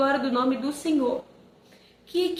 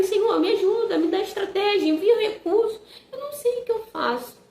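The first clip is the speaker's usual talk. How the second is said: higher, urgent pleading to God; pleading prayer; 5 semitones higher than usual. Retold or imitated. Mother retelling her prayer for money for son, higher pleading. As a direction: cry out to the Lord for help.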